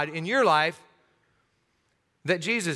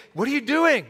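high, sharp what are you doing; car owner catching someone in his car, high and sharp; startled owner; 8 semitones higher, 3 dB louder than usual. Pretend. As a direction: challenge a car thief from the porch. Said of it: "you come out and you say".

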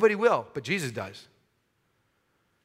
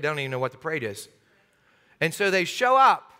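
ordinary delivery

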